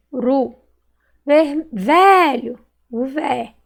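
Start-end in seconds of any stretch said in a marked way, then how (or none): 1.7-2.6 "velho" slow and loud, long VE; stretched stressed syllable. Shown as a demonstration. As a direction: call out "velho", holding VE. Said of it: "Qual que eu demorei mais falar".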